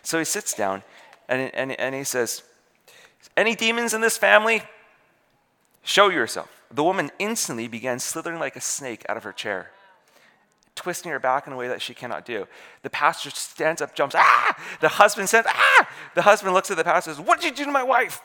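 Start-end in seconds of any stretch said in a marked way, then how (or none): 3.4-6.5 raised, higher challenge, imitating an exorcist; commanding pastor. Pastor challenging demons in prayer. 14.2-14.6 loud mock scream of fright; startled yelp. Pastor jumps up in fright. 15.5-15.9 loud imitated scream of shock; startled yelp. Husband screams at the sight. 17.2-18.2 high, hammed-up frantic husband; panicked accusation.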